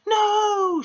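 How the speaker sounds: very high, loud cry of shock; cried out. A narrator's reading. Headmistress seeing her dead enemy's name, shocked cry. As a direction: wail the word in horror. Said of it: "she cried".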